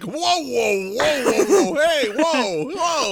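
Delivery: high, loud panicky whoas; rising startled protest, hammed up; mock alarmed doctor. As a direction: back away in a hammed-up panic.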